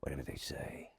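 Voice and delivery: hushed film-actor whisper. Shown as a demonstration. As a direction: whisper it like a moody screen actor. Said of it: "they always speak like that".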